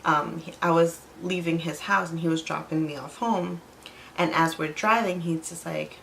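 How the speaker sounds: usual speaking voice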